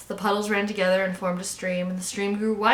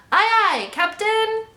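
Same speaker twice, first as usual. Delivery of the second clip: very high, loud youngster voice; chorusing little toadlets; 13 semitones higher, 6 dB louder than usual. A narrator's reading. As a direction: answer the captain in an eager high pipe. Toadlets answer their captain.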